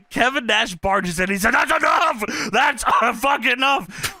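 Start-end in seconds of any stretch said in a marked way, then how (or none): 1.5-4.2 high mock shout, slowing down; imitated shouting. Imitating a wrestler breaking up a row, mock shout. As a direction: barge in with a hammed-up holler.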